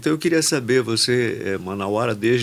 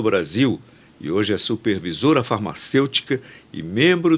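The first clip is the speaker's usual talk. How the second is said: upbeat announcer read introducing the guest; podcast host intro; 3 dB louder than usual. Acted. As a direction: present the show and welcome the guest on air.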